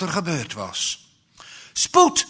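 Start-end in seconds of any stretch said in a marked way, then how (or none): 1.7-2.3 high, loud urgent cry; urgent shout. A narrator's reading. Mother on the phone to emergency services, shouting. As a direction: yell it down the phone. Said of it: "riep ze".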